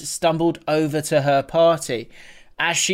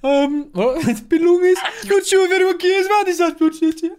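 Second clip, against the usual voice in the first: high, fast Birmingham accent attempt; Brummie accent; 12 semitones higher than usual. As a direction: try a rushed Brummie accent. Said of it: "I can't do a Brummie accent".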